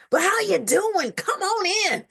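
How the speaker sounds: lightly voiced cheerful host; cheery greeting. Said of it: "greeting clients at the door".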